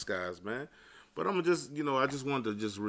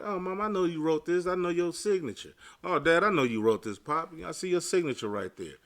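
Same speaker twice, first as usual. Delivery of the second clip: a hint of an eager child, higher; lightly voiced child; 6 semitones higher than usual. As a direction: play the kid who spots the handwriting. Child recognising parents' handwriting.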